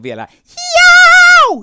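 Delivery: very high, loud metal shriek; drawn-out rock scream; heavy metal scream. Shown as a demonstration. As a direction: let out a long heavy metal yowl.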